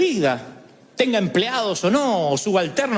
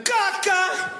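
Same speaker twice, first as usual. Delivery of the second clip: high, curt mock retort; blunt mom answer; 12 semitones higher than usual. Mother answering what's for dinner, high and curt. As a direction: snap it back like a fed-up mother. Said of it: "Eran escatológicas para contestar".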